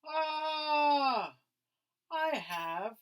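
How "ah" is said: loud, high exasperated "Ah"; a cry of writer's block; frustrated shout. A narrator's reading. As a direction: burst out in frustration. Frustrated outburst.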